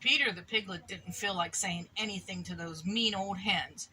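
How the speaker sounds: plain reading voice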